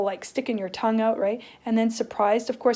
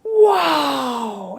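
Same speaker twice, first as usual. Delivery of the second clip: surprised wow; 3 semitones higher, 7 dB louder than usual. Shown as a demonstration. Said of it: "what we do when we're surprised".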